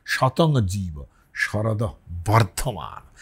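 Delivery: slow Sanskrit chant; mantra recitation. Shown as a demonstration. Teacher chanting a Vedic blessing, slow and measured. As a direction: intone the verse slowly.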